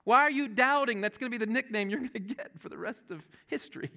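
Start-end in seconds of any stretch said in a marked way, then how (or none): 0.0-1.0 a higher, exasperated come-on-Thomas voice; mock scolding. Jesus chiding doubting Thomas, higher and exasperated. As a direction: chide him like a fed-up friend. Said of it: "He doesn't look at him with, like".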